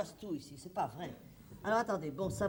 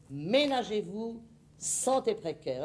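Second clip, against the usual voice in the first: slow horoscope reading; slower than usual. Acted.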